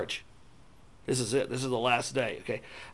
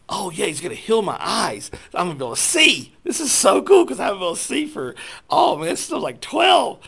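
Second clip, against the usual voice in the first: excited; 7 semitones higher, 6 dB louder than usual. Retold or imitated. Excited retelling of expected healing.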